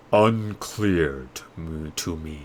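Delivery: slow, low and distant, like a voice from beyond the glass; an ancient mirror delivering a verdict; slow solemn magic mirror; oracle mirror voice. Acted.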